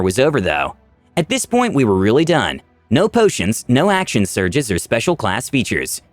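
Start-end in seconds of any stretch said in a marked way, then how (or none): none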